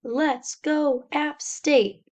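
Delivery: mock rallying cry; imitated band yell. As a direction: cheer it like the band. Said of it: "stops playing and yells".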